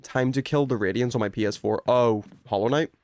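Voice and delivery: ordinary talk, nothing marked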